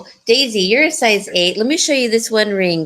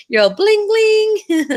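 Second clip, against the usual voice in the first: high singsong, second word even higher; teasing high-pitched exclamation; playful bling-bling; 13 semitones higher, 4 dB louder, slower than usual. Pretend. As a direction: flash it with a high, playful ring. Playful mock of flashing the ring.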